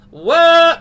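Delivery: loud, drawn-out open "whoa"; a big belted holler; belty, powerful call. Shown as a demonstration. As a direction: throw it out forward and loud. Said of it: "give it that belty, powerful".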